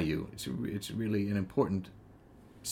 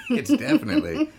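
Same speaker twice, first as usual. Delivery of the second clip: high burst of laughter through the words; real laughter breaking into the line; laughing; 6 dB louder than usual. Real, not acted. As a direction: laugh it out, caught off guard. Laughing at the meta idea.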